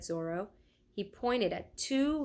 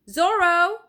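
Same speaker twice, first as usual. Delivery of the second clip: loud call across the hall, higher; 10 semitones higher, 12 dB louder than usual. A narrator's reading. Leonardo calls across the hall to Zorro, loud call. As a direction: call the apprentice's name across the room. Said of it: "called Leonardo".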